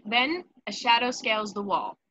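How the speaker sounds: ordinary delivery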